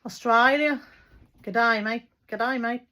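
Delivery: amateurish put-on Australian twang; attempted Australian accent. Vlogger practising Aussie greetings for fun, badly faked Australian accent. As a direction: have a clumsy go at an Australian accent. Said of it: "why I try and do accents".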